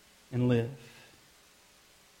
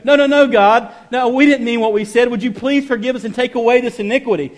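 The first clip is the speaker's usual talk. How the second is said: louder, higher begging for forgiveness; pleading Israelites; 8 semitones higher, 6 dB louder than usual. Retold or imitated. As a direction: beg God as the guilty people. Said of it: "and they would go".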